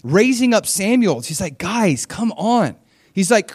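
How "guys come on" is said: exasperated. Retold or imitated. Samuel exasperated with people.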